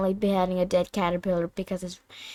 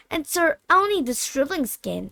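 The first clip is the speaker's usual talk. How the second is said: malicious laughter; 6 semitones higher than usual. A narrator's reading. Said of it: "his voice full of malicious laughter".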